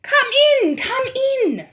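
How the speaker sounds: loud, high impatient witch snap; snapped. A narrator's reading. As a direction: hurry them in sharply. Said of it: "snapped the Grand High Witch".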